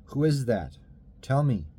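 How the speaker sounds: put-on a boy calling into the dark; frightened call. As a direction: demand an answer from the dark, scared.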